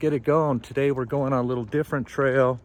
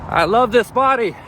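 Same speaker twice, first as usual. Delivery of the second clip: loud mantra; 9 semitones higher, 5 dB louder than usual. Real, not acted. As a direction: shout the mantra high and loud. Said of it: "Just keep saying that mantra".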